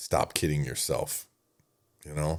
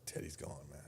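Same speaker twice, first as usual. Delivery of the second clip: hushed grief; 3 semitones lower, 20 dB quieter than usual. Retold or imitated. Friend breaks news of death.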